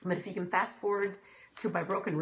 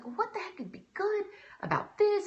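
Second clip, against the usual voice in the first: exasperated; 14 semitones higher than usual. Retold or imitated. Exasperated question to herself.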